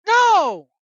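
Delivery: a sudden outraged shout; loud indignant no. Real, not acted. Indignant rant answer.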